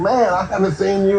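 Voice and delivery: mock delight. Continues fake reunion act.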